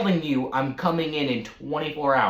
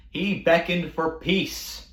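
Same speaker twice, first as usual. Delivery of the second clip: ordinary talk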